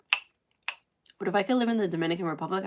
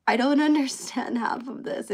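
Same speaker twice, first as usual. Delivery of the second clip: laughing quote; 7 semitones higher, 5 dB louder than usual. Retold or imitated. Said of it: "I'm like".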